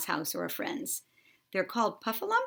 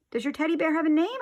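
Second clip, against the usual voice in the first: higher, louder and faster repeat; 10 semitones higher, 4 dB louder, faster than usual. A narrator's reading. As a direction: push it louder so he hears. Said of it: "I said louder".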